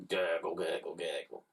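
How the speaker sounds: low, soft bubbling gurgle; gurgling noise. A narrator's reading. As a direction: gargle it like slime in a pipe. Slime noise.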